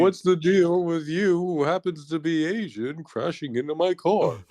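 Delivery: lightly ape a standup bit; stand-up comedian. Retold or imitated. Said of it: "you become, like, a stand-up comedian".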